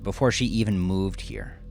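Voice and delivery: ordinary delivery, nothing marked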